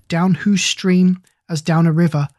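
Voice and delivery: ordinary delivery, nothing marked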